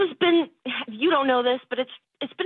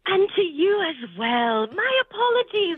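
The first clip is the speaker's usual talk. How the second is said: fake British accent; 4 semitones higher than usual. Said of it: "drop the British thing".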